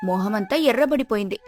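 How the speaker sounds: plain reading voice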